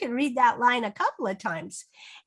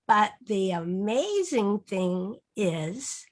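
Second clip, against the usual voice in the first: drawn out with a rising tune on amazing; slow read-aloud; 4 semitones lower, much slower than usual. Shown as a demonstration. Teacher reading the refrain to children, slow and rising. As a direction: stretch the line so kids can join in. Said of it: "read that last line with it".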